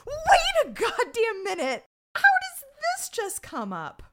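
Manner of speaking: very high, loud and slow; incredulous outburst. Retold or imitated. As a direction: stretch it out in hammed-up disbelief. Acted incredulous reaction to retold revelation.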